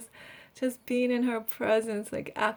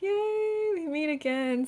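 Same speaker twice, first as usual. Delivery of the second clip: high, sung-out yay of reunion; singsong happy reunion cheer; excited cheer; 6 semitones higher, slower than usual. Pretend. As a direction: greet a long lost friend with joy. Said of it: "and you're like".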